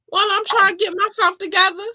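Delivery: high, outraged falsetto voice; high, shocked, indignant woman. Acted. Acting out the victim's outrage.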